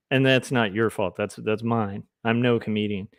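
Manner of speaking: normal talking voice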